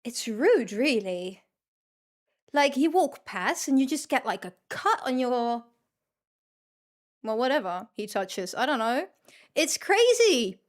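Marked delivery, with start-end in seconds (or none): none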